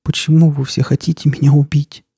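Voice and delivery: put-on high, thin voice of a hurt girl; whispered plea. A narrator's reading. Wounded girl asking the stalker why, in a whispered plea. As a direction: plead in a small high voice, only half whispering. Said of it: "прошептала Настя".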